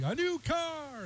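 mock prize reveal, very high and soft; game-show announcer. Announcer reveals a car on Price is Right, drawn-out mock cry. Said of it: "You say".

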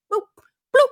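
high, popping boops; imitated flip noise. Radio host mimicking politicians flipping over, high playful boops. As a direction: toss in cartoonish sound effects, pitched high.

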